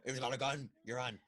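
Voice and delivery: badly faked movie tough-guy impression; terrible Al Pacino. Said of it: "That's a terrible Al Pacino impression".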